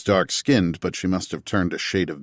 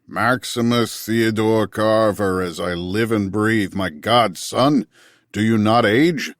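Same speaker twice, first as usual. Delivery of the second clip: louder booming greeting, slow start; hearty colonel; 3 semitones higher, 4 dB louder than usual. A narrator's reading. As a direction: hail an old friend across the room. Colonel greets old comrade.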